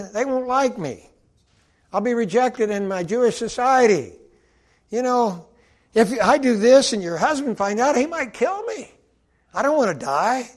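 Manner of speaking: a panicking slave piles up selfish excuses; frantic excuses. Retold or imitated. Joseph the slave pleading his fears to his master's wife, rising to a loud, high panic. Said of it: "He says".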